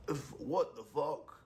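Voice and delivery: bad Russian accent, high and slow; half-hearted Russian accent. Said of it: "I was trying to do a Russian accent".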